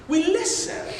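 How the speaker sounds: listen lifted high with a rise; stress on listen. Shown as a demonstration. Preacher lifting listen for the congregation. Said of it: "We don't walk around and tell".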